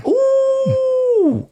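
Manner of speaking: high, sudden delighted "ouh"; amazed high-pitched whoop; excited whoop. Real, not acted. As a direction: let out a thrilled hoot at the smell. Sniffing a strong beer.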